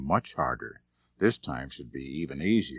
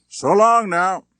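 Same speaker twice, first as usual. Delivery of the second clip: much higher, louder parting call; calling goodbye; 14 semitones higher, 13 dB louder, slower than usual. Acted. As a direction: sing out a hearty farewell. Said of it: "the big man called after them".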